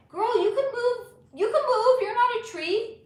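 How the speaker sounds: high, loud teasing voice; squeaky mock voice. Streamer answering chat in a high squeaky voice. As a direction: squeal it like a cartoon cheerleader.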